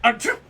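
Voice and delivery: loud fake achoo; hammed-up sneeze; mock sneeze. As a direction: act out a sneeze. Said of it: "Phoenix, he's like".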